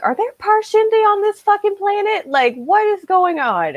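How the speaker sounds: high-pitched incredulous squeak, slowing down; shocked disbelief. Retold or imitated. Fan recalling her shock at the reveal, squeaky incredulous. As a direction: blurt it out in sudden high disbelief. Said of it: "I was like".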